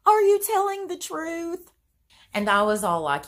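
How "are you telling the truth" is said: much higher, louder, crying disbelief; imitating a sobbing little girl; tearful girls. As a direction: squeak it out like a frightened child in tears. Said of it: "With tears running down their face".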